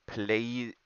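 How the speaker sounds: "play" said drawn out as a mangled please; quoted misspelling. Retold or imitated. Player reads out opponent's plea, quoted misspelling. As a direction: say "play" in place of please, pleading. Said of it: "He's saying plays".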